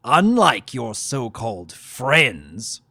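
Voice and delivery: slow, loud, mocking the word friends; sneering. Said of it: "neon quotation marks flashed".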